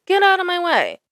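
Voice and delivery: high mocking get out of my way; mock bossy. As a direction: push the line up high like a snotty driver. Mocking truck drivers.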